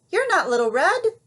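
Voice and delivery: high, loud accusing shout; Granny calls out the impostor; yelled. Acted. Granny yelling at the wolf at the door. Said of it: "yelled Granny".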